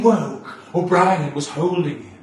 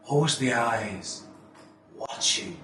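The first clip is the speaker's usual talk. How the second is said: soft, breathy dread; hushed; 4 semitones lower, 7 dB quieter than usual. Acted. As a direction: let it fade to a whisper. Hushed stage line.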